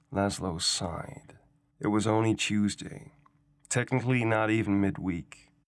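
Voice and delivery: usual speaking voice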